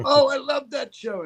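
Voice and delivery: high, gushing imitation of a fan; retold fan excitement. Fans seeing a piece of the show, excitedly nostalgic.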